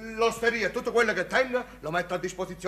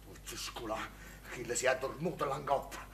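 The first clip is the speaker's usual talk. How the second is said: muttered aside; 8 semitones lower, 10 dB quieter than usual. Acted. Innkeeper's muttered aside curse.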